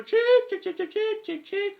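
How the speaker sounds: cheep like a taunting chick; squeaky mocking chicken calls.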